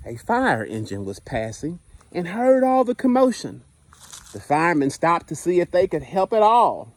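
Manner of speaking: lively picture-book narration, dwelling on the punchlines; reading to children. Acted. Teacher reading Stuck to the class, storytime voice. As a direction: read it like storytime on the carpet.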